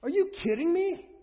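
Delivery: high-pitched, astonished exclamation; incredulous. Acted. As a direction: ask it in rising disbelief. Preacher amazed at Jesus's claim.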